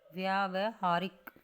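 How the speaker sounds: slow, drawn-out व्यावहारिक with long aa; syllable-by-syllable demo. Shown as a demonstration. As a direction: break व्यावहारिक slowly into vyaa-va-haarik. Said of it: "यह के आ की मात्रा है".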